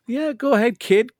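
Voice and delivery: high, casual taunt, not an imitation; Darth Vader. Villain barely trying against Luke, mock nonchalant taunt. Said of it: "Darth Vader barely did anything".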